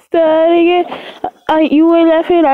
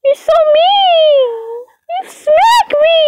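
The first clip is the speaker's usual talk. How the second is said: much higher, slow, hammed-up whiny complaint; mock whine; 10 semitones higher than usual. Mother teasing her child after a playful smack, slow mock whine. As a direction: play the wronged victim, slow and pitched way up.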